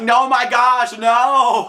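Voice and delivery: high, loud, hammed-up freak-out; panicked yell. Girl to friend who says calm down, hysterical.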